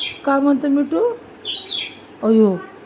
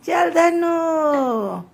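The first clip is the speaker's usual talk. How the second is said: long drawn-out, higher-pitched urging; playful call to horse; 5 semitones higher, much slower than usual. Pretend. Parent to child on toy horse, playful drawn-out call.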